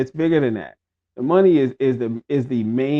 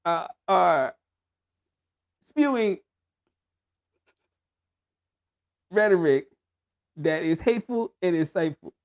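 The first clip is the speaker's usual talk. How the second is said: halting, word-by-word politician impression; mock statesman with very long pauses between words; Hakeem Jeffries. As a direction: deliver it haltingly, freezing after every word or two. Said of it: "Do your Hakeem, you have to, you have to say this with, in your Hakeem voice".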